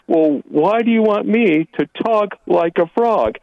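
a hint of a baffled grandpa; lightly voiced grandpa. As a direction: draw the question out, pitch lifted. Caller voices puzzled grandpa in joke.

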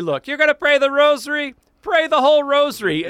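put-on high, loud comic Portuguese accent. Priest playfully laying down his rule on the rosary, mock Portuguese accent. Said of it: "That's my Portuguese accent".

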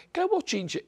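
high, squealing gossip's cry; gossip character. Retold or imitated. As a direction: exclaim it like the neighborhood busybody. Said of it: "este personaje es".

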